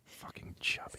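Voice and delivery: barely audible whisper; faint, hushed ASMR question. Pretend. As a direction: whisper very softly into the mic. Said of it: "Can you do some A S M R".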